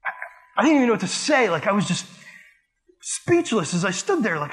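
ordinary talk, nothing marked

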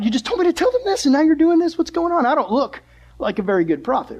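high-pitched, flustered complaint to God; exasperated protest. Acted. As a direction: protest like you've been made to look foolish. Jonah protesting God's change of mind.

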